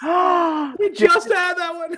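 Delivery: high, slow, loud exclamation; loud protest. Real, not acted. Host catching a repeated punchline, loud high protest. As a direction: cry out in amused protest, stretched out.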